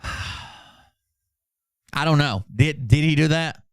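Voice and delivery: usual speaking voice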